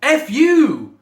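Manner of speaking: very loud, high-pitched angry yell; acted furious outburst at full volume; exasperated shout. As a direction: explode at them. Acted outburst at the mumbling girl.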